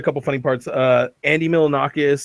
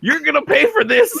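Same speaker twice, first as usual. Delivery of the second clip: much higher, squeaky mock threat; mock angry boss; 3 dB louder than usual. Retelling the counselor scolding the fallen guy, squeaky mock threat. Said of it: "he's like".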